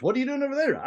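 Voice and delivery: very high, squealing cartoon voice; high Robin Williams-style character voice. Shown as a demonstration. Teacher showing Robin Williams switching voices: very high squeal. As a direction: pitch it way up like a zany character. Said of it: "get back to Robin Williams".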